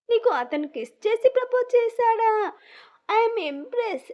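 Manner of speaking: high-pitched, impressed amazement; keen interest. A narrator's reading. Sister hearing about the kiss proposal, keen interest. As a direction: lean in, thrilled by the gossip. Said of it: "Shashi ఇంట్రెస్టింగ్గా".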